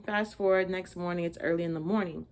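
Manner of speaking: ordinary delivery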